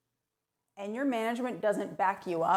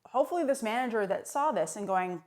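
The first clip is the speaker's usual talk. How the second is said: normal talking voice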